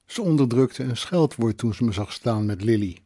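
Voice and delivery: plain reading voice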